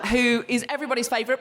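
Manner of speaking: usual speaking voice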